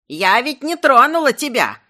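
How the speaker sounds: put-on loud, high old-crone squeal; shrieking old woman. A narrator's reading. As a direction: shriek in frantic protest. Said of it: "истошно взвизгнула рогатина каким-то старушечьим голосом". (истошный старушечий визг)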